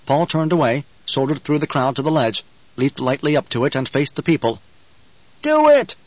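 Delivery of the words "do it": very high, loud cry; shriek. A narrator's reading. Someone in the crowd urging Paul on, shrieking. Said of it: "someone shrieked".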